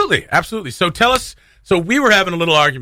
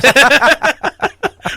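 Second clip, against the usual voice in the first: loud squealing laugh, much higher; high burst of laughter; 12 semitones higher, 4 dB louder than usual. Real, not acted. Host cracking up at a co-host's silly Gollum voice, laughing high.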